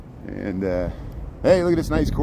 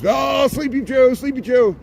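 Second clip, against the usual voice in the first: slow, much higher mocking taunt; taunting sing-song; 12 semitones higher, 5 dB louder, slower than usual. Vlogger mimicking critics who mock Biden, slow high taunt. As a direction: drag out the nickname like a playground jeer.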